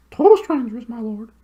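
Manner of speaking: very high hooting owl voice, far from the narrator's own; high, hooting bird voice. Acted. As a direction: hoot it like a big owl. Owl to the dwarf.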